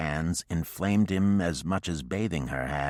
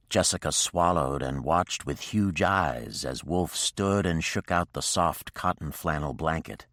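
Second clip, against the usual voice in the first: usual speaking voice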